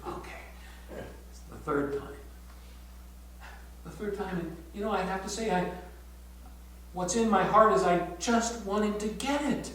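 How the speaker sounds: normal talking voice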